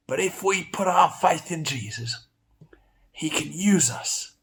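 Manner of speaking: usual speaking voice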